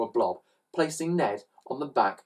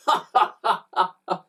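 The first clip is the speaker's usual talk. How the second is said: acted villains' cackle; burst of laughter; 6 semitones higher than usual. A narrator's reading. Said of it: "burst out laughing".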